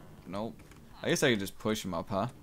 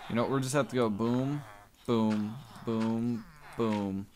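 ordinary delivery

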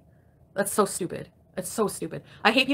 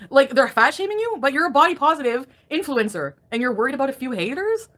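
higher, louder exasperated rant; indignant rant; 5 semitones higher, 5 dB louder than usual. Real, not acted. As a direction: let the outrage climb. Ranting at harassed influencer.

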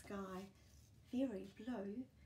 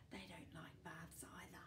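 much softer secret whisper; 3 semitones lower, 11 dB quieter than usual. Acted. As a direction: confide it behind your hand. Confiding about her own dogs.

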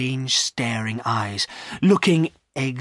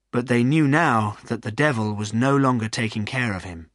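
ordinary delivery

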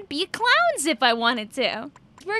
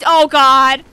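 ordinary delivery

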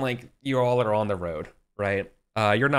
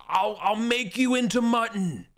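higher, slow mock bellow, muffled like through a helmet; mock helmet yell; 9 semitones higher than usual. Gawain imitating the giant yelling at him, mock bellow. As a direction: ham up the Irish giant's threat. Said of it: "he's just yelling through his helmet".

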